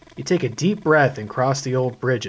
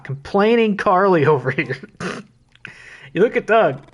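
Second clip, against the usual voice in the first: ordinary talk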